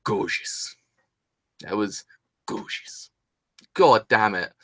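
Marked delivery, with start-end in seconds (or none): 0.0-0.8 slow, low, softened catchphrase; Greg Wallace. Retold or imitated. Fan quoting a catchphrase about the kiss, savouring imitation. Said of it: "as Greg Wallace would say in England". 1.7-3.1 low, hushed catchphrase fading to a whisper; Greg Wallace. Retold or imitated. Fan quoting a catchphrase about the kiss, whispered imitation. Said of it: "as Greg Wallace would say in England".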